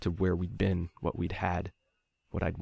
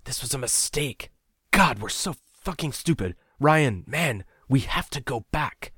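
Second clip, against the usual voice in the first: panicked plea; 8 semitones higher, slower than usual. A narrator's reading. Nico panicking, wants to turn back.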